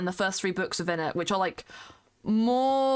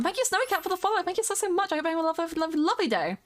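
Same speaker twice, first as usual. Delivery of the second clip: high bright thanks; 8 semitones higher, much faster than usual. Real, not acted. Thanking a follower on stream.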